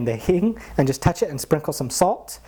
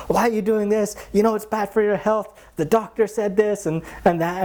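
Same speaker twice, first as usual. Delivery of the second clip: higher, scolding mother tone; mock chastising; 8 semitones higher than usual. Maharaj chastises guru over sweets, high mock scolding. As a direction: nag him about his health.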